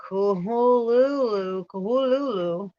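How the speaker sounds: Kuhululu said as koo-HOO-loo-loo, aspirated and drawn out; guttural koo-hoo-loo-loo; Lovecraft's pronunciation. Shown as a demonstration. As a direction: sound out Kuhululu syllable by syllable. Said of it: "Lovecraft transcribed the pronunciation of".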